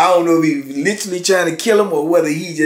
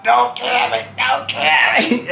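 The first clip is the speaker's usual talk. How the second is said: high, slow pleading, don't kill me; voicing a man begging for his life; high drawn-out plea; imitated begging; 9 semitones higher, slower than usual.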